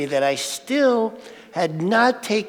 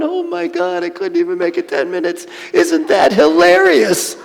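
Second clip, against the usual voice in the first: laughing at himself; 10 semitones higher, 3 dB louder than usual. Retold or imitated. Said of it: "I'm laughing so hard".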